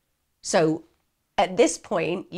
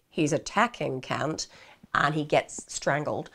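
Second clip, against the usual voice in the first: ordinary delivery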